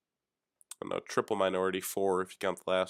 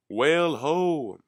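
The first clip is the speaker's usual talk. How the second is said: loud, high, drawn-out holler; whaler's call; 7 semitones higher, 7 dB louder, much slower than usual. Acted. Imagining old-time whaling, a long loud call of a sighting. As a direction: sing out like a lookout at sea.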